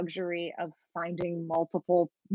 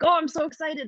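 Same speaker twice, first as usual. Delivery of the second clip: higher-pitched burst of mock excitement; retold excitement; 9 semitones higher, 4 dB louder than usual. Player recalling jumping into the game, retold excitement. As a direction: replay your own giddy "oh!" moment. Retells own giddy reaction during play.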